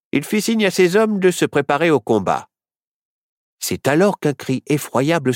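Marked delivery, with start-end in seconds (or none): none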